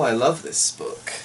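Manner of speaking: ordinary delivery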